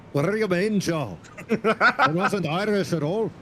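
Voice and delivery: hammed-up indignant Scottish accent; bad Scottish accent. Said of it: "mistaking an Irish person for a Scottish person".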